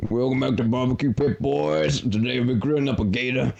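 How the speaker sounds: hammed-up Southern barbecue host; Southern cook show host welcoming the boys; exaggerated American accent. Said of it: "my American accents are very exaggerated".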